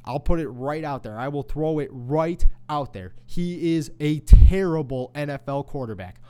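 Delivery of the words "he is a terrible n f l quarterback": louder, pounding out the insult; loud verdict. Real, not acted. Host condemning the quarterback, loud and blunt. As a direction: declare it like a final ruling. Angry rant about Bradford.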